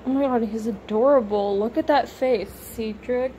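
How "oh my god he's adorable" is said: ordinary delivery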